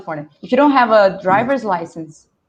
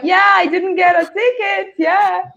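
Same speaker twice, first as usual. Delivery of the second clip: triumphant; 8 semitones higher than usual. Retold or imitated. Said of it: "It was like".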